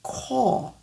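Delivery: "Call" with the tongue held still; "Call" without the L, tongue steady; English call. Shown as a demonstration. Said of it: "ma langue ne bouge pas".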